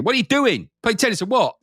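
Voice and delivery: hammed-up impatient challenge; mock aggressive. Game menu imagined nagging the player, mock aggressive. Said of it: "It's almost aggressive with you".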